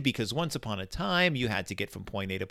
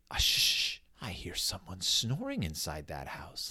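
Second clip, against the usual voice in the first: mock hushed whisper; 7 semitones lower, 9 dB quieter than usual. Mock whisper at neighbour's house.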